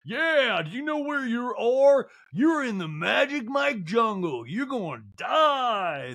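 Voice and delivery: high, loud hype-man holler; hammed-up slow MC impression; strip-show MC. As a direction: work up the crowd with long stretched shouts. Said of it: "And M C's like".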